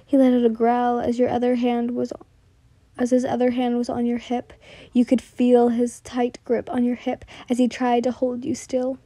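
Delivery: usual speaking voice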